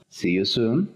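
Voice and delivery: "See you soon" with "soon" said "soohn"; long oo in soon. Shown as a demonstration. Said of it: "No decimos con doble O".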